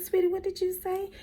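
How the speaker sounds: high-pitched, disbelieving sweet-talk; incredulous teacher. Retold or imitated. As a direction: question a child in high disbelief. Teacher questions insulting child.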